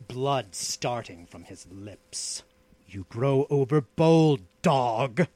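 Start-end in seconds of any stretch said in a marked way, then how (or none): 2.9-5.3 mildly menacing growl. A narrator's reading. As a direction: master warning an insolent slave, voice altered. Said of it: "growled the outlaw".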